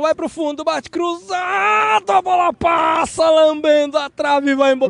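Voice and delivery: rising shout on a near miss; excited commentary. Real, not acted. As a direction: yell it as the ball skims the post. Near-miss shot called live.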